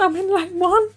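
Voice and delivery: high, loud panicky self-quote; imitating her panicked phone call. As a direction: squeal it lost on the motorway. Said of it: "And I said".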